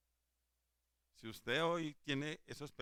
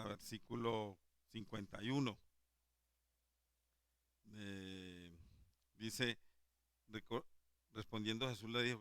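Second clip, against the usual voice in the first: normal talking voice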